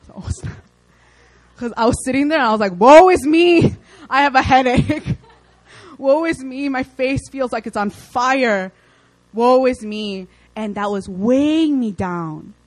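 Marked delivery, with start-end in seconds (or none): 2.8-10.3 melodramatic self-pity, played for laughs; mock wailing about a headache; mock lament. Mimics his own self-pity.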